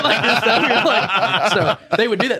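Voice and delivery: can't stop laughing as he tells it. Real, not acted. Officer recalling inmates blown off their feet, laughing.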